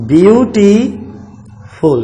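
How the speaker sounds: ब्यूटीफुल drawn out slowly, broken as ब्यूटी, long pause, फुल; split into syllables. Shown as a demonstration. Teacher showing the class it can't be said at once, ब्यूटीफुल split in two. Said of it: "इसको आप एक साथ नहीं बोल सकते".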